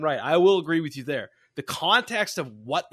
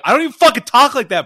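high, loud, quick mock protest; hammed-up indignant objection; Bobby; 7 semitones higher, 11 dB louder, faster than usual. Friend objecting to the accent teasing, loud mock protest. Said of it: "Bobby's like".

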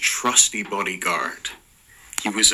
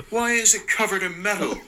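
high, fast grossed-out question; 11 semitones higher, faster than usual. Acted. Eric grossed out by the metal genitalia, high and fast. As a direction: recoil and fire it off.

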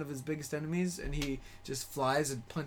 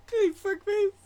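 laughing through the words; 6 dB louder, slower than usual. Pretend. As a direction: giggle it out. Repeating the line laughing.

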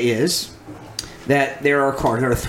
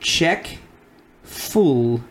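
sounding out words; 3 semitones higher, much slower than usual. Shown as a demonstration. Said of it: "reading them slowly".

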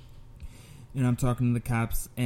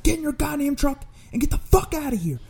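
very high-pitched angry order; retold yell; 3 dB louder than usual. Delivery man orders attacker to leave, high yelling. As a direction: yell an exasperated command, acted out. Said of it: "So I yell".

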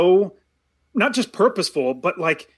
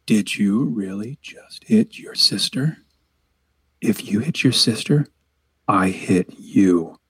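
menacing; 11 semitones lower, slower than usual. Shown as a demonstration. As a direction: warn the child slowly in a deep voice. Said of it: "That second one is much more menacing".

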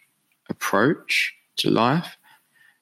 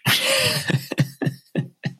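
high, breathy-tailed chuckle at his own joke; laughing at himself. Real, not acted. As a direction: laugh at your own boast.

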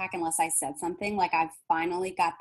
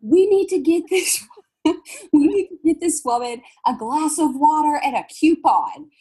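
high, loud, urgent clerk voice on the walkie-talkie; hammed-up store clerk calling for help; dramatic clerk; 9 semitones higher, 8 dB louder than usual. Store clerk calls over her walkie-talkie, dramatic urgent voice. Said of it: "It's so dramatic".

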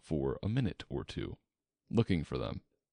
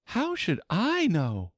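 high, drawn-out retort; high-pitched, plucky Alice; bold Alice; 13 semitones higher, 4 dB louder than usual. A narrator's reading. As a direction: answer back boldly in a high girl's voice. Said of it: "surprised at her own courage".

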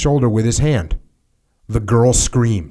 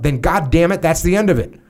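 higher-pitched, pressing officer's demand; angry superior; 6 semitones higher than usual. A narrator's reading. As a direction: read the colonel's anger pitched up. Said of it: "immediately began screaming".

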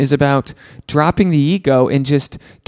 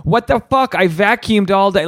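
high, indignant squeak of defense; husband snapping back at his wife about chores, mock indignant; mock outraged self; 8 semitones higher than usual. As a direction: whine it back like a wounded husband. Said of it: "And I'll be like".

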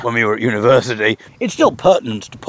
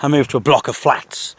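ordinary delivery

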